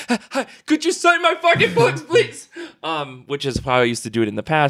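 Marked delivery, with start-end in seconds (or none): 0.1-2.5 squeaky high, laughing nervous plea; jittery fan blurting at a creator; shy fan begging for a signature, high and giggly. Retold or imitated. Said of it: "on edge when I'm just like".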